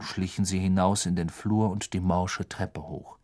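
usual speaking voice

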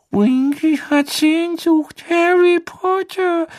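hohe Elfenstimme, langsam und betrübt; trauriger Hauself. A narrator's reading. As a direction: sag es wie ein bekümmerter Elf. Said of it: "flüsterte Dobby traurig". (hohe, traurige Elfenstimme)

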